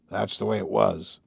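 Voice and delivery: put-on subdued old man giving up; quiet resignation; resigned, sad. A narrator's reading. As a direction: let it sink away sadly. Old man's broken ending of tale.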